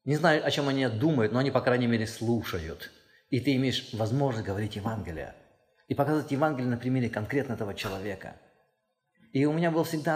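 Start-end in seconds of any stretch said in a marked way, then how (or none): none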